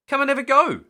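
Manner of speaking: high, loud call across the waves; a loud, high friendly call. A narrator's reading. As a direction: call him over to try. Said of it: "called Sam".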